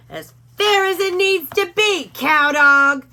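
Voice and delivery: a bully dog snarling at Hank; menacing Doberman. A narrator's reading. Rufus voice.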